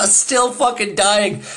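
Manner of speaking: high, strained wheezing cough; coughing fit. Retold or imitated. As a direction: still choking on the smoke. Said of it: "he's like".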